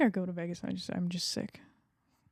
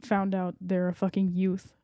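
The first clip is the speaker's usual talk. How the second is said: usual speaking voice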